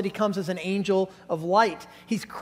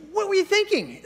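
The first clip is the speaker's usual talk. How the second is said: exasperated; 11 semitones higher than usual. Retold or imitated. Leader scolding boy.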